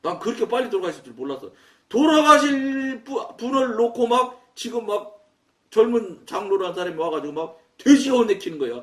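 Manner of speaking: ordinary talk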